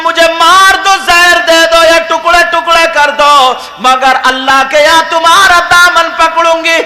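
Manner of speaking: loud sermon chant in the woman's voice; preached lament. Acted. Woman complaining to the king, loud preaching chant. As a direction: plead your wrongs at full force.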